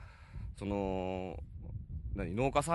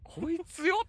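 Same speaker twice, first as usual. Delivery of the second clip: mock kid taunt, laughing; 12 semitones higher, slower than usual. Retelling kids mocking him.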